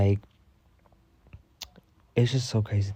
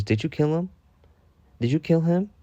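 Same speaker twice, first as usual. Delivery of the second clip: mock interrogation; 7 semitones higher than usual.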